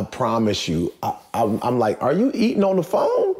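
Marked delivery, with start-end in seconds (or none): 2.0-3.3 high-pitched disbelieving question; mock-astonished, laughter creeping in; incredulous retold question. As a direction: ask it in amused disbelief, voice lifting at the end. Retelling a disbelieving phone call.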